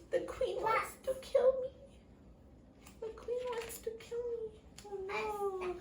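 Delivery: high, plaintive mock crying. A narrator's reading.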